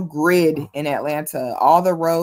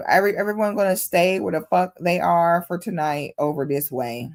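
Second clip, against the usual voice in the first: ordinary talk, nothing marked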